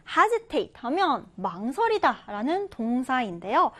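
ordinary talk

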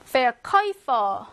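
"Verkäufer" drawn out very slowly, äu as oi; slow German word. Shown as a demonstration. Teacher slowly sounds out "Verkäufer" for the class. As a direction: stretch "Verkäufer" syllable by syllable. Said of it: "umlaut에 우 있으면요".

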